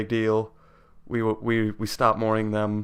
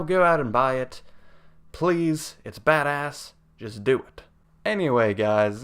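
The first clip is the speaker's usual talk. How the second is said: normal talking voice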